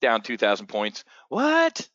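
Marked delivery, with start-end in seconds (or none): 1.3-1.9 surprised what. Acted. Mock shock at market drop.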